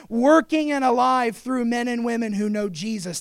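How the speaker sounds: loud, high, fervent sermon voice; full-force preaching. Acted. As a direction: preach it out over the crowd.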